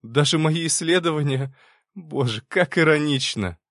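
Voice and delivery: high, stunned irony; bitter shock. A narrator's reading. Girl seeing the irony of her research, high bitter shock. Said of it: "звук, похожий на смех, но без веселья, только горечь и шок".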